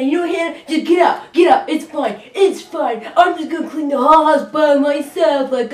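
wife mimicking husband's complaints while cleaning, loud and nagging; impression of Neil. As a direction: rag on her like a bossy partner. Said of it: "Do your best vocal reenactment of me".

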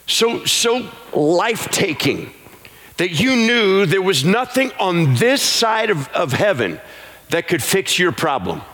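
high, slow, sung preaching over music; rising revival preacher chant; full-force preaching. Acted. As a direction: lift the congregation into a sung plea. Preaching builds into sung appeal.

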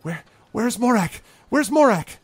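high, loud urgent cry; panicked villager. Acted. Villager runs over searching for Morak, panicked shouting. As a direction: call out frantically for someone missing.

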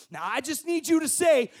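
loud, high, pushing demand; menacing persecutor. Retold or imitated. Persecutor to a martyr, loud menacing demand. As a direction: lean on him like a captor.

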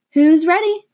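put-on rally the kids to the door; excited exclaim. A narrator's reading. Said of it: "Missus Gann exclaimed".

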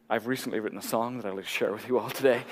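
low, soft, self-important announcement; put-on humble-brag politeness; mock performer introduction. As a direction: introduce your song with hushed mock earnestness. Mocks egotistical self-introduction to audience.

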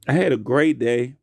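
usual speaking voice

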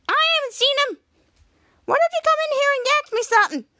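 squeaky tiny character; tiny high voice. Retold or imitated.

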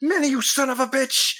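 high, sharp accusing cry; angry florist outburst. Acted. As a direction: spit out the name in recognition and rage.

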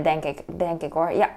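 ordinary talk

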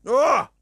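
loud, high "ugh" of revulsion; revolted outburst; disgusted groan. Real, not acted. Disgust at the story.

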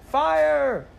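terrified crowd screaming fire; shrieking. A narrator's reading. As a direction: scream it like the theater is burning. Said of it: "Then people shrieked".

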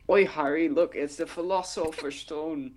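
hammed-up British movie quote; mock British accent. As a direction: put on a silly high British voice. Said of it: "I just thought of, uh, like".

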